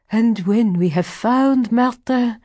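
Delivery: high, pressing woman's voice; intense, urgent question. A narrator's reading. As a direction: ask it urgently, pitched high. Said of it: "in a hoarse whisper".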